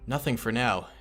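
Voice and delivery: ordinary talk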